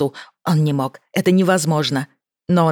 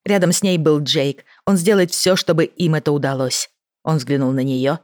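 ordinary delivery

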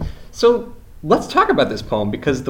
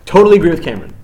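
higher and louder, encouraging echo; enthusiastic echo; 5 semitones higher, 7 dB louder, faster than usual. Real, not acted. Teacher echoes a shy student.

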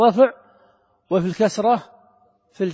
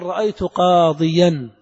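رأيت قاضيا said slowly and louder, each word set apart; slow model example; 6 dB louder, slower than usual. Shown as a demonstration. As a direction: spell out رأيت قاضيا for the class. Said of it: "فتقول". (مثال يُنطق ببطء ووضوح)

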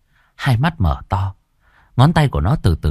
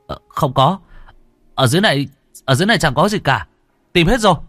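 put-on higher boyish voice, quick and offhand; boy lying calmly; 8 semitones higher, faster than usual. A narrator's reading. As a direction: brush them off, nothing to see here. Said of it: "nó đáp".

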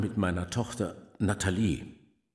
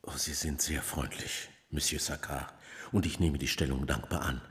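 softer, lower, humble gratitude; quiet thanks; 6 semitones lower, 8 dB quieter than usual. Acted. Humble father thanking Saccard for the job, quiet and lower. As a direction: murmur your thanks, bowing low.

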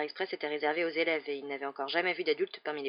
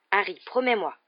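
higher, louder urgent plea; insistent, speeding up; 3 semitones higher, 7 dB louder than usual. A narrator's reading. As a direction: press him hard for a promise. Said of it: "en parlant de plus en plus vite".

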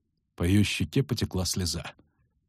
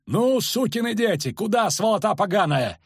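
high-pitched angry roar; yelling; 11 semitones higher than usual. A narrator's reading. Kagyr halts fleeing soldiers, yelling abuse. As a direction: bellow abuse at deserters. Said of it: "орал он".

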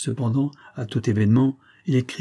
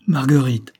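nom lancé plus haut et plus fort; s'écrier; 4 semitones higher, 6 dB louder than usual. Acted. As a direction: crier son nom, surpris et furieux. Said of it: "s'écria le comte". (le comte découvre sa femme à la porte et s'écrie)

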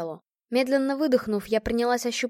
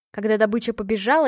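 plain reading voice

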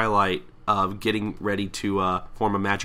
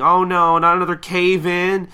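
high, loud mock alarm; acted panic; 7 semitones higher, 8 dB louder than usual. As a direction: cry out like a panicking crowd. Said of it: "everybody just starts panicking".

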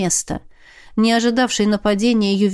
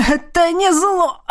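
desperate shout; 8 semitones higher than usual. A narrator's reading.

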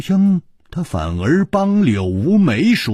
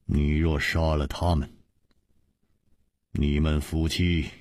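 low, soft warning; composed and hushed; calm Chu Liuxiang; 9 semitones lower, 7 dB quieter than usual. A narrator's reading. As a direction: reason quietly against the threat. Narrator voices Chu Liuxiang.

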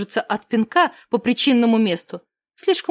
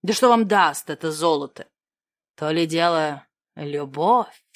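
низко, медленно, напыщенно; вещай с пафосом о любви; пафосный эльф; 8 semitones lower than usual. Acted. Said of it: "Он взялся за пафосные речи". (пафосный тон)

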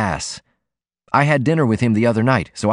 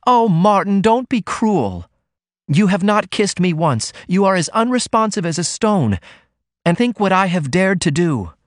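sharp cry; 9 semitones higher than usual. A narrator's reading. Ruth cries out to Martin, who will not kiss her: higher, hurt plea. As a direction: cry out to him, wounded. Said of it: "She gave a sharp cry".

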